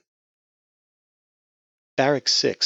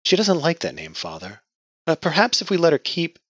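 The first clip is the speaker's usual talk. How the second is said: much higher, hesitant plea; timid pleading; 10 semitones higher, 6 dB louder than usual. Acted.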